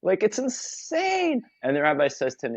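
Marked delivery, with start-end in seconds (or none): 0.0-1.4 much higher, indignant squeal of disbelief; the pious man can't believe it, voice shooting up; outraged disbelief. Retold or imitated. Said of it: "he goes".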